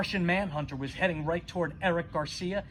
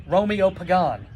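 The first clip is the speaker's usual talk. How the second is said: plain reading voice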